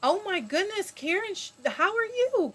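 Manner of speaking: high, loud delighted hello; thrilled high greeting; excited greeting. Real, not acted. Greeting an old friend excitedly.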